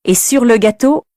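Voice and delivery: usual speaking voice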